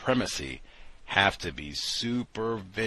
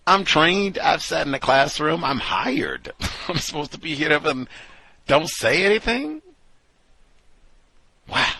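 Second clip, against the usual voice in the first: outraged disbelief; 6 semitones higher, 5 dB louder than usual. Real, not acted. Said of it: "outraged at the treatment".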